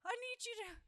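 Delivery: high, slow, loud whimpering plea; mock a believer begging in a panic; cowering plea. Cowering believer begging Jesus for help, hammed-up high wail. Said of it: "So why do we cower?".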